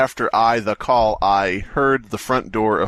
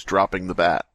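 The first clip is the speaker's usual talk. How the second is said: usual speaking voice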